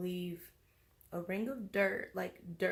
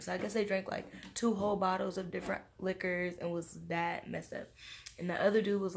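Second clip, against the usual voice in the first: normal talking voice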